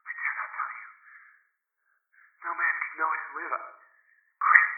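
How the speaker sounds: hoarse, despairing whisper; hoarse with fear. A narrator's reading. Friend refusing to tell what he saw, fearful hoarse whisper. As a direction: whisper it haltingly, tinged with despair. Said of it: "still hoarse with fear and now apparently".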